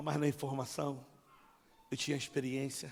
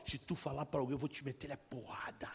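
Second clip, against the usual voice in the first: hushed, lowered intimidation; quiet threat; 2 semitones lower, 7 dB quieter than usual. Retold or imitated. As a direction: threaten under the voice, letting it drop away. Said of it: "um homem que falava pra mim".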